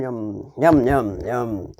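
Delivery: ordinary delivery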